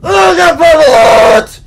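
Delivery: high, loud screamed outburst; very angry. Real, not acted. Player exploding over the game, angry scream. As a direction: scream it in frustration. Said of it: "I'm very angry".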